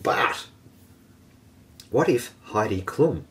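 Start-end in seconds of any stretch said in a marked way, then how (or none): none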